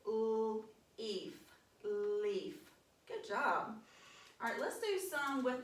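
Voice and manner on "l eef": "l-" split off, then "eef" with a long ee; teacher showing the silent A; sounding out. Shown as a demonstration. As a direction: segment "l-, eef" slowly. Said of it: "y'all say it with me".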